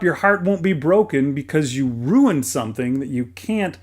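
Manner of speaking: ordinary talk, nothing marked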